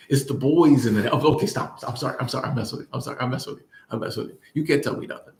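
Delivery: ordinary delivery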